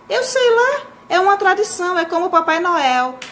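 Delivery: high-pitched, acted "who knows" answer; exasperated parent. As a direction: toss off an explanation, exasperated and high. Parent cornered by child's question. (resposta impaciente ao filho, bem aguda)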